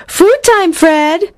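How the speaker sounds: high, cheery call to dinner; calling the dog. A narrator's reading. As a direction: sing out mealtime to the dog. Calls the dog to eat.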